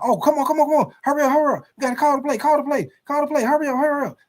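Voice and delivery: high, rushed panicking teammate. Acted. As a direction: hurry everyone before the clock runs out. Panicked hurry before clock ends.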